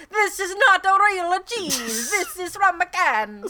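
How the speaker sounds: put-on high hammy Italian accent. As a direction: overdo a fake Italian accent. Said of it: "a terrible Italian accent".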